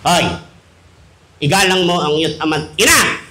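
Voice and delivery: low, solemn mock quoting; pious parent. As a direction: intone the commandment. Parent quotes commandment back.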